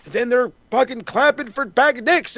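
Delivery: ordinary talk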